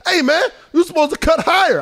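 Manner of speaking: high, loud scolding; angry scolding. Retold or imitated. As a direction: scold your card partner. Oakley scolding his partner.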